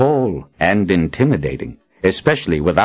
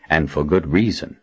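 ordinary delivery

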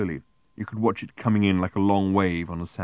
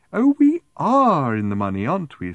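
long smiling sigh; 8 semitones higher, 6 dB louder than usual. A narrator's reading. Woman seeing Arthur's fifty pence, long smiling sigh. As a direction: sigh it out high through a smile. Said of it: "with a long, smiling sigh".

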